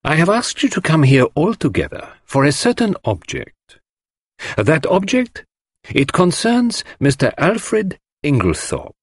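high-pitched, measured lecture to a gathering; Poirot, lecturer's address. A narrator's reading. Poirot tells the gathered household his object, ceremoniously. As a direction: announce it like a celebrity speaker. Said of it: "as though he were a celebrity about to deliver a lecture".

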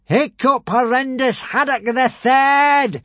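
high, slow, booming announcement; villainous shout. A narrator's reading. Alvin summons his enemy before the crowd, shouting. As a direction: stretch out each name at full voice. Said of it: "shouted Alvin".

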